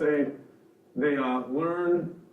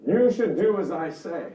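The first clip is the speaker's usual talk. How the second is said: loud, pitched-up parental order; forceful, directive parent voice; authoritative parent; 4 semitones higher, 8 dB louder than usual. Acted. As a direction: lay down the law as a demanding parent. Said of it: "Parent voice would be authoritative".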